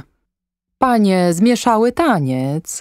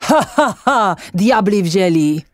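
mock laughter. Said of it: "śmiejąc się".

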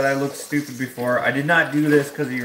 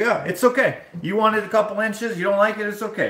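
higher-pitched, placating mimicry of the barber; mock placating barber; 9 semitones higher than usual. Barber brushes off an unhappy customer's complaint, mock placating. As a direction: brush off the complaint in a high mocking voice.

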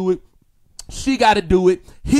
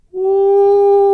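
long, high, loud "woo"; sustained exultant holler; excited whoop; 9 semitones higher, 7 dB louder than usual. Real, not acted. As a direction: hold a ringing high whoop. Excited preacher's exclamation.

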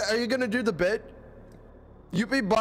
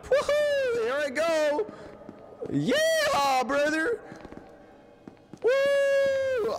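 hyped whoop; much slower than usual. Real, not acted. Hyping himself up mid-fight, high whoop.

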